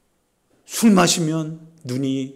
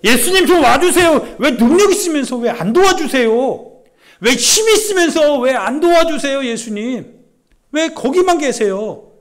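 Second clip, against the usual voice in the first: high, loud whining plea with a laugh; pleading paralytic; 10 semitones higher, 8 dB louder than usual. Acted. Preacher voicing the paralytic begging Jesus, high whining plea. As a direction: beg Jesus like a frustrated invalid. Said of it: "우리는 원하는 게 이거예요".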